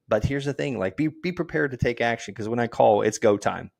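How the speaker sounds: ordinary delivery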